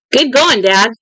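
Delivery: narrated excited praise; congratulating. A narrator's reading. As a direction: cheer Dad on with a lift in pitch. Son high-fives his father, congratulating him.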